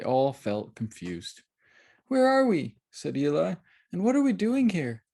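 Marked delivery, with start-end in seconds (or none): 2.1-2.8 put-on confused child voice. A narrator's reading. 3.9-5.0 put-on confused child voice. A narrator's reading.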